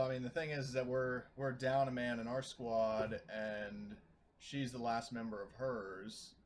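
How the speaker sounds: ordinary talk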